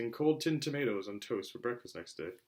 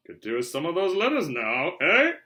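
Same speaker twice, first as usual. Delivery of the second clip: cheerful, louder, higher gloat; 6 semitones higher, 10 dB louder than usual. A narrator's reading. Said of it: "he said cheerfully".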